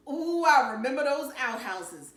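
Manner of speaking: delighted recall. Acted. Dad delighted at old memory.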